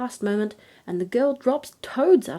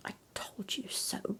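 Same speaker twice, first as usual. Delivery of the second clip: hushed whisper to her husband; queen whispering aside to the king; 13 dB quieter than usual. A narrator's reading. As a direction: breathe it, barely voiced. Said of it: "she turned to the king and whispered".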